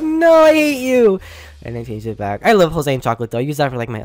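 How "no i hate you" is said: slow high petulant no; slow, squeaky mock-bratty retort; high mock tantrum. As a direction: throw a playful high-pitched tantrum. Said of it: "I was like".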